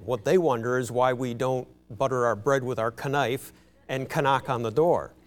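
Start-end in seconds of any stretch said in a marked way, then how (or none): 3.1-3.5 "knife" with the K pronounced, kuh-NIFE; sounded K. Shown as a demonstration. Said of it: "have the K's pronounced". 4.2-4.5 "knock" with the K pronounced, kuh-NOCK; sounded K. Shown as a demonstration. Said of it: "have the K's pronounced".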